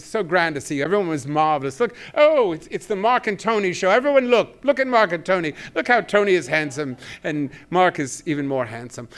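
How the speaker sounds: high, loud, grand and gushing; grand sixties New York manager. Retold or imitated. Manager gushing over Mark and Tony, grand and high. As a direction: gush like a grand showbiz manager fussing over stars. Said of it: "And he would talk like this".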